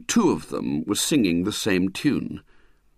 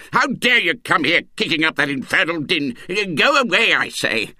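high, loud angry bellow; furious; 5 semitones higher, 3 dB louder than usual. Acted. Uncle yelling at noisy boys, furious bellow. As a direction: roar them off the lawn.

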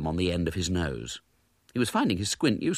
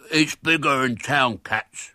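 slow, lightly throaty cover-up; a boy patching a slip; hastily; 5 semitones higher, 4 dB louder, slower than usual. A narrator's reading. William covering his slip, lightly throaty. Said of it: "he said hastily".

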